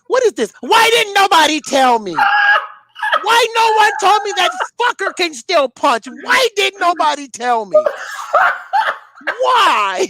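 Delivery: imitating a shocked boxer in the dressing room; bewildered outrage. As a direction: demand answers like a stunned fighter. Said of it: "Nordine Al-Ubali was like".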